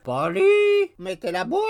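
high croak, half-asleep bird; low raven cry. A narrator's reading. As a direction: mumble it like a drowsy raven. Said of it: "qui criait de temps en temps à voix basse".

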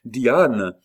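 urgent full-voiced call; cried out. A narrator's reading. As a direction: shout her name in alarm. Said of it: "cria-t-il".

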